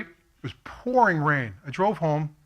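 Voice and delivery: normal talking voice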